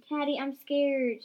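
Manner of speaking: drawn-out frightened plea; shivering with fear. A narrator's reading. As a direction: whimper it out long and scared. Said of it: "shivered Spade".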